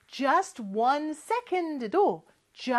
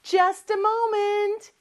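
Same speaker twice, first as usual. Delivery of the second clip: busy dad; 8 semitones higher, 3 dB louder, much slower than usual. Retold or imitated. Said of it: "너무너무 바쁜가 봐요".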